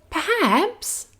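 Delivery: put-on high, slow, kind girl voice; little girl. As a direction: soothe the hurt dragon like a little girl. Princess speaks.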